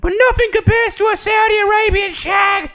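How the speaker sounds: put-on very high, shouted British old-woman falsetto; Whitney Cummings. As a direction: bellow it as a hammed-up sitcom granny. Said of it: "on stage just going".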